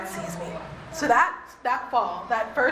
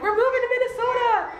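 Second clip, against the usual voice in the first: high, louder excited news; excited announcement; 11 semitones higher, 3 dB louder than usual. Retold or imitated. Telling her mom on the phone about the move, excited. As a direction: burst out with the big news.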